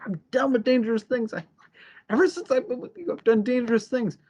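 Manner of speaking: high-pitched retold complaint; mock exasperation. Charles done with dangerous things, mock exasperation.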